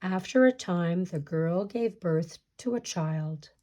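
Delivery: usual speaking voice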